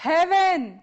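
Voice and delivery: firmly projected word for repeating; loud model word. Shown as a demonstration. Teacher leading students in loud repetition, called out. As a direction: call it out for a class to echo. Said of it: "મોટેથી બોલજો".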